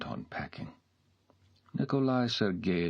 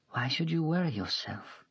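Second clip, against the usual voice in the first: put-on higher, whispered in places, an offended young woman; hurt young maid; 11 semitones higher than usual. A narrator's reading. Mashenka voiced, tearful.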